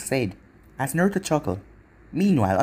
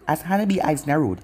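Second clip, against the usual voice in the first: plain reading voice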